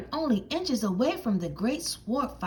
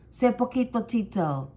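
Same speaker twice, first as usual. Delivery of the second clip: usual speaking voice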